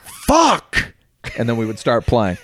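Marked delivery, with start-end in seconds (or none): 0.0-0.9 high shouted curse; a rock singer's yelled curse; mock shout. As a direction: yell it way up high. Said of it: "I'd go".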